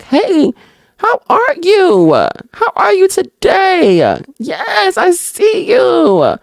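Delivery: very high, drawn-out sing-song hello; gushing mock greeting. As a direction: greet an old friend in a squeaky, stretched-out voice. Acts out greeting failure like a friend.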